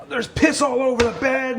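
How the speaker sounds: high-pitched mock yell; retold screaming. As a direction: re-enact a panicked shout without full volume. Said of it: "I'm screaming and I'm yelling".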